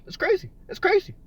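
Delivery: high squeaky it's crazy; squeaky exclaim. Real, not acted. To Pat in chat, high squeaky disbelief.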